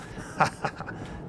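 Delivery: soft, breath-heavy villain cackle; acted gloating cackle. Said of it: "William Mayfield cackles".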